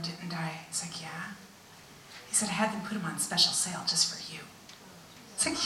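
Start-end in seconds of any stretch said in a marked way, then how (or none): 0.0-4.4 soft whispered voice of God; God confiding in a whisper; tender whisper. Retold or imitated. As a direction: whisper it lovingly.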